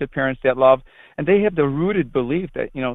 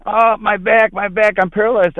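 higher-pitched imitation of a man crying out; mock screaming; 6 semitones higher, 4 dB louder than usual. Driver claiming injury to the priest after a bump, mock screaming. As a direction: act out a hammed-up cry of pain. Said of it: "He started screaming".

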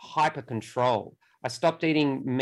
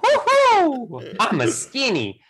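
high, excited cartoon Italian; Mario voice; 10 semitones higher, 6 dB louder, slower than usual. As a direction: cheer like a video game plumber. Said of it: "like Mario from".

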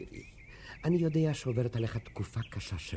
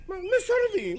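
shocked outcry; 7 dB louder than usual. Acted. Official shocked by refusal.

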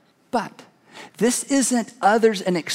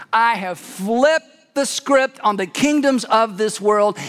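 ordinary delivery

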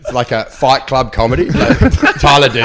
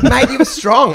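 higher, laughing fake praise; mock reassurance; 9 semitones higher, slower than usual. An audience member fibbing to the comic, high mock reassurance. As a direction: play a polite audience member lying.

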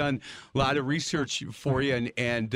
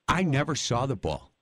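usual speaking voice

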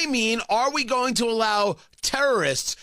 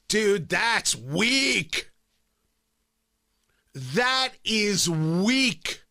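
very slow, dragged-out "weak"; slow verdict; much slower than usual. Real, not acted. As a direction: let "weak" hang with contempt. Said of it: "drawn-out verdict".

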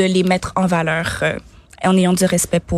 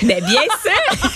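laughing; 11 semitones higher, slower than usual. Real, not acted. Proud answer about her success.

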